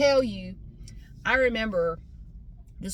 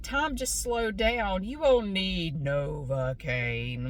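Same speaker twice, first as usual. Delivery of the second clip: slow, lower mock-calm dentist voice; 3 semitones lower, slower than usual. Teller mocking the calm dentist, slow and low. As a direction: drag it out, lower, like a dentist soothing. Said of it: "it's like".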